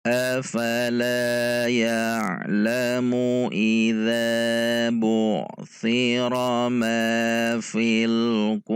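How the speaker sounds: measured Quranic chant; Quran recitation. Shown as a demonstration. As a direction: recite it melodically, drawing out vowels.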